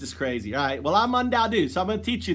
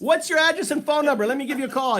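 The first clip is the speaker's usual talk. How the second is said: higher-pitched game-show excitement; mock prize announcer; 6 semitones higher than usual. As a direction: keep up the fake winner hype. Keeps up fake prize announcement.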